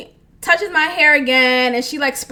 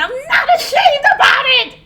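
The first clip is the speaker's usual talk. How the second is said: high, loud shout through laughter; high-pitched laughing holler; laughing defiant shout; 12 semitones higher, 5 dB louder than usual. Real, not acted. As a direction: shout it proudly, cracking up.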